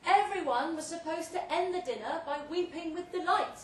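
booming tale told through a loud hailer; grand loud speech. Acted. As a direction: hold forth like a host at a banquet. Speech to guests via loud hailer.

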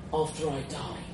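soft, strained last words; faint deathbed voice, acted; dying, barely managing. A narrator's reading. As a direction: play a dying hero squeezing out a final request. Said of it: "I managed to say".

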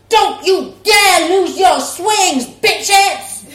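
loud, slow, with a laugh at the end; rally the mums; loud defiant rallying cry; fierce shout. Acted. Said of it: "she shouted".

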